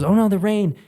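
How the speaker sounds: high-pitched imitation of companion; mock dismay. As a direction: act out her little alarm. Companion's dismay at rain acted out.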